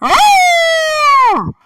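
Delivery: two screeched roars; dragon screech. Acted. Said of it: "I was screeching".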